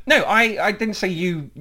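ordinary talk, nothing marked

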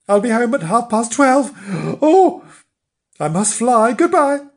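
high, loud flustered rush; flustered hurry. Acted. Flange late and flustered.

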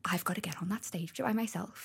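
hushed dread at having to go up alone; whispered inner thought on hearing her name; whispered panic. Retold or imitated. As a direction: whisper the worry under your breath.

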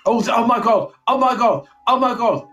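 high, loud hammed-up screaming; mock terror. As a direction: overact a terrified ghost hunter. Mock fright at ghost noise.

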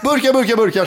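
mock shouting vendor. Friend hamming up a can seller's shout. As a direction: yell burkar like a street seller. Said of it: "Han bara skriker".

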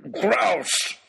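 high, wailing shout; loud cry with a pleading edge; plaintive bellow. A narrator's reading. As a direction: bellow it like a thwarted hunter. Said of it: "bellowed Oxshott, almost plaintively".

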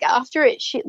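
ordinary delivery

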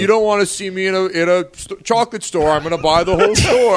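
hammed-up Nick bragging about chocolate; Nick impression. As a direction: gush about buying the whole store. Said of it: "doing the full impression".

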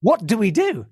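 high-pitched, exasperated alarm; retold panic. Producer begging a colleague for a plan, acted high-pitched panic. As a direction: re-enact pleading for a way out, pitched high. Retelling panic at losing host.

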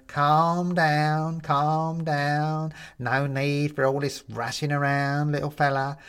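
high, slow would-be soothing skeleton; calm him like a creepy old bone; eerily soothing skeleton voice. A narrator's reading. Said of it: "probably supposed to be a soothing tone".

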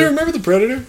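very high-pitched parroting; echoed mock reminder. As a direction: mimic your co-host's pestering question. Echoes co-host's mock question.

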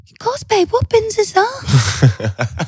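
high, very slow gormless drawl. Retold or imitated. Wife mocks husband's gormless reply, high and dragged out. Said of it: "What is that face and voice you keep doing?".

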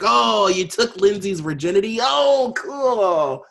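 high, loud fake admiration; mock impressed. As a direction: gush with exaggerated, sarcastic awe. Mocking an impressed radio host.

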